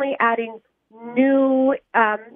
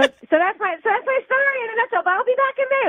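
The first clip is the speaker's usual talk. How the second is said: excited; 9 semitones higher, faster than usual. Real, not acted. Excited high wrap-up, rising pitch.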